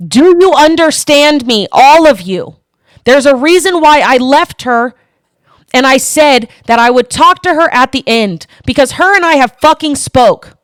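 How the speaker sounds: fired-up, forceful telling-off; real angry yelling. Real, not acted. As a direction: yell it at the whole room, punching the swear words. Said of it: "I won't stop fucking yelling at you".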